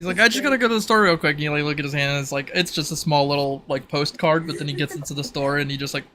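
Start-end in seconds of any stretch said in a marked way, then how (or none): 0.2-1.3 quick, higher, louder imitation; breezy quoted excuse. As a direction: toss it off as a hurried excuse. Acting out a character's casual excuse.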